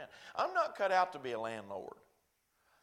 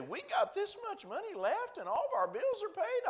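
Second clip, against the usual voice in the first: put-on much higher, pleased old woman; old lady voice; 4 dB louder than usual. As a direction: chirp it out like a proud old lady.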